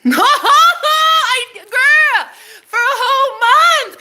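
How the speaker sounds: very high, loud words through laughter; giddy, loud laughing gossip; triumphant laughing. Real, not acted. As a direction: cackle and squeal the words out slowly.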